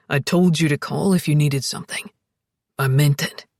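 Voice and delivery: low, firm man's voice; deep, earnest reassurance; earnest Alex. A narrator's reading. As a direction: insist in a deep, steady voice. Alex reassures Zoe, means it.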